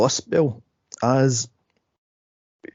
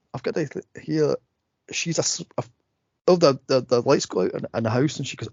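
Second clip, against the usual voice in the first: normal talking voice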